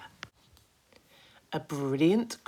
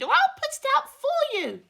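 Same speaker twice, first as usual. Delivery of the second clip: high, loud fussy creature voice; a bossy high-pitched centipede nagging; 6 dB louder than usual. Acted. Centipede fussing at James over his boots, high and loud.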